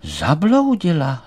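high, loud alarmed butterfly; high-pitched dismayed exclamation; startled. A narrator's reading. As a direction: call out in fright, stretching the last word. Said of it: "lekl se Emanuel".

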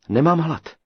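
high, loud, curt refusal; curt snapping reply. A narrator's reading. Captive girl refuses the giant's supper, curt snap. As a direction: snap back at him, high. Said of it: "ocekla dívka".

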